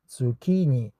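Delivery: "Zucchini" with a hard K, zoo-KEE-nee; corrected pronunciation. Shown as a demonstration. Learner copying the dictionary pronunciation, "Zucchini" said zoo-KEE-nee. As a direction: say "Zucchini" with K as in key. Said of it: "I heard about the pronunciation before".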